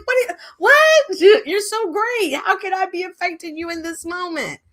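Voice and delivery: high-pitched, hammed-up astonishment; mock disbelief. Answering someone who says they hate her, mock astonished. Acts her reply to being hated.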